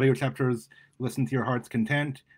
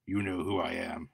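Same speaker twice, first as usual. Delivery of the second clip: low menace; 5 semitones lower, 6 dB quieter than usual. Acted. Menacing attacker speaks.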